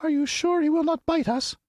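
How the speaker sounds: very high, hesitant little squeak; timid mouse queen. Acted. Mouse queen peeking from the grass, timid squeak. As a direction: ask nervously from hiding. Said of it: "asked in a timid voice".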